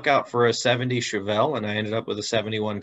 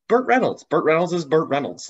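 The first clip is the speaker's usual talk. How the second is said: high, loud burst of admiration; excited; 6 semitones higher, 4 dB louder than usual. Real, not acted. Guest gushing about his childhood hero, excited. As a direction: blurt the name like a fan. Exclaiming hero's name.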